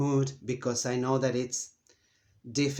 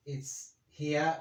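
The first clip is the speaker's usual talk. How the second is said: ordinary talk, nothing marked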